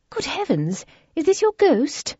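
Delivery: 1 lady's voice. A narrator's reading. Isabella, a lady, voiced.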